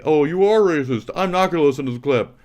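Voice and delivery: high, loud sneering parody of a chatter; mocking chat voice. Streamer mocks chatters who won't listen, in a loud high sneer. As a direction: mimic the dumb chatter, nasty and fast. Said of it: "And you say".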